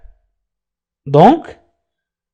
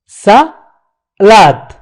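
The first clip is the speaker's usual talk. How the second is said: salade with a clear break, sa, then lade; split into syllables. Shown as a demonstration. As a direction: pull salade apart after sa. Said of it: "c'est la première syllabe".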